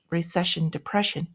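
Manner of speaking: ordinary delivery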